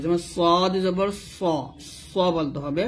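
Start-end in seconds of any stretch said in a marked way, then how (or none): 0.3-1.4 "সদ জবর স" slow, with a thick, dark s; heavy Arabic sad. Shown as a demonstration. Teacher showing learners heavy sad, thick "স". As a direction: draw out "সদ" with a heavy, rounded s. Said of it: "সদ মোটা করতে হবে".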